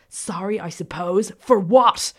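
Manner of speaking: mocking question building to a loud bark. A narrator's reading. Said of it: "and Fernandez barked".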